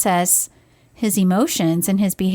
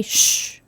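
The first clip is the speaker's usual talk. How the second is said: shushing. Retold or imitated. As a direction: hush like a teacher quieting kids. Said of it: "Why did you say".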